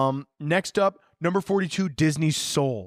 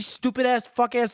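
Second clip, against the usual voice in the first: ordinary talk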